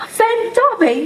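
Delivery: high, choked-up disbelief. A narrator's reading. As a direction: squeeze it out high through tears. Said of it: "choked the elf".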